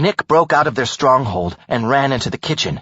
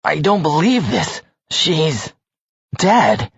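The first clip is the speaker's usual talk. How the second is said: grieving; 4 semitones higher, slower than usual. Acted. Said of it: "let out a trembling breath".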